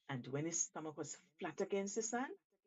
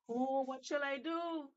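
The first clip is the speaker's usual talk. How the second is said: wailing; 7 semitones higher, 3 dB louder than usual. Acted. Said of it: "wailed Shark".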